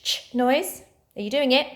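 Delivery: ordinary talk, nothing marked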